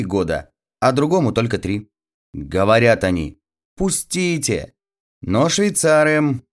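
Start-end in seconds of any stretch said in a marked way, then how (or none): 3.8-4.7 put-on high small-child voice; lightly acted child's plea; pleading little boy. A narrator's reading. Small boys plead at ball door.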